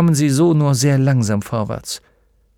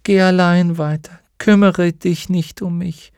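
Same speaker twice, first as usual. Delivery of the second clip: lightly voiced horse, higher, slow at the end; resigned horse; 6 semitones higher than usual. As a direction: let go of all hope. Artax giving up.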